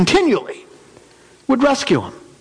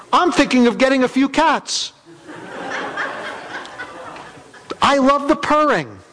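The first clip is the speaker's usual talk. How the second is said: cheerful shout; 6 semitones higher than usual. Acted. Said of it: "[name] shouted out".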